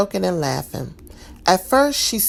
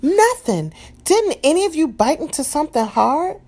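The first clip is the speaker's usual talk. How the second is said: anxious question; 10 semitones higher, 3 dB louder than usual. A narrator's reading. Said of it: "Maria asked".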